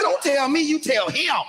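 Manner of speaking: father snaps back at coach; high exasperated retort. Retold or imitated.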